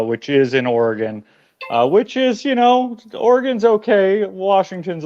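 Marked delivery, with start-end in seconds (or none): 1.9-5.0 higher, playful teasing. Acted. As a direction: tease the rival state lightly. Teasing the Oregon players.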